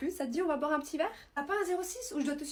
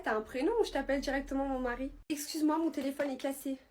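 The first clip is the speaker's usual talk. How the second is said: normal talking voice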